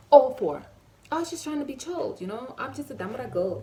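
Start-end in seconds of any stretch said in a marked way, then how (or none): 0.1-0.6 sudden loud shout; much louder, higher declaration. Acted. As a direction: blast the last words at him. Confronting the waiter.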